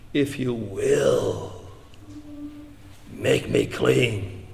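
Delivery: slow, hushed leper's plea, hint of a rasp; raspy leper's plea. Leper coming up to Jesus for healing, hushed raspy plea. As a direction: beg very softly and slowly, word by word. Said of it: "a raspy voice".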